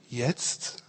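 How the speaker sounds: soft, low question; low and hushed, lifting into a question; calm query. A narrator's reading. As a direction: ask it quietly, unhurried. Computer calmly queries the impatient men.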